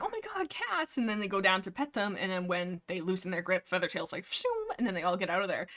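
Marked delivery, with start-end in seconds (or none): 0.0-1.0 high, soft squeal of delight; thrilled kids. Acted. Two Leg kids go to pet cats, high mock excitement. 4.2-4.7 high, airy "pshoom"; zoom-off sound effect; whooshing getaway noise. Retold or imitated. Feathertail escapes the kids' grip, high whoosh. Imitates cat shooting away.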